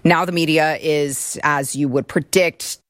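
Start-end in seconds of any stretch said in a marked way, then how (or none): none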